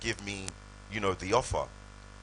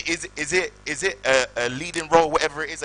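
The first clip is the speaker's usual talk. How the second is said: much higher and louder, tripping over words; excited stammering; 8 semitones higher, 10 dB louder than usual. Retold or imitated. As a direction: blurt it out, barely able to contain yourself. Excited question at agent.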